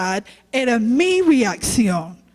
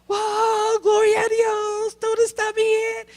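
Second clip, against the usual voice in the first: much higher, bright put-on praise voice; fake church cheer; 10 semitones higher than usual. Pastor mocking her own fake Sunday smile, much higher. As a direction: sing out a fake happy hallelujah.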